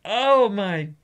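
high, wailing cry of exasperation; exasperated outburst. Real, not acted. Player venting after a hated segment, exasperated. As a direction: wail it out dramatically to the heavens.

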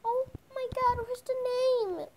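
high, eager gushing; excited. Pretend. Shy pony asking about the baby dragon, excited. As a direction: gush over the little creature.

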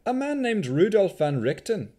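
put-on Vistani accent. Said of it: "Vistani accent".

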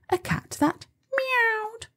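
meowy high voice; high meowing voice. A narrator's reading. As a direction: squeak it up high like a cat.

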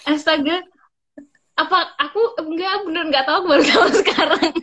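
high, loud, laughing astonishment; amazed disbelief. Real, not acted. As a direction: laugh through your real surprise. Astonished she never knew this.

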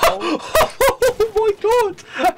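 slow, high laughing exclaim; laughing in amazement. Real, not acted. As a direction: drag it out while laughing. Amazed at a play.

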